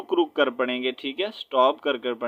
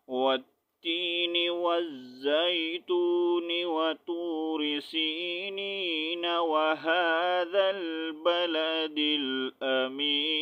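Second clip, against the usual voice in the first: verses run together in one breath, higher and slow; joined recitation; 4 semitones higher, 4 dB quieter, much slower than usual. Shown as a demonstration. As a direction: recite without stopping, linking each verse. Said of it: "تو کیسے پڑھوں گا؟". (ملا کر ایک سانس میں تلاوت)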